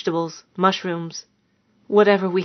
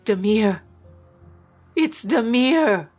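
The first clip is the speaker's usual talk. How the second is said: awed gasp building to a higher, drawn-out cry; shocked recognition; 4 semitones higher, slower than usual. A narrator's reading. Woman seeing her dead husband's shade, shocked. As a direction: breathe the name, then raise it in disbelief.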